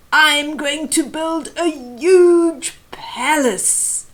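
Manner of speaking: a grand boastful proclamation; grand announcement. A narrator's reading. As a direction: announce it like a proud eagle. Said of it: "he announced grandly".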